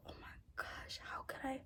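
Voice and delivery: whispered, retold shock at herself; hushed disbelief. Woman recalls realising she disliked herself, hushed disbelief. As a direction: drop to a breath, as if stunned.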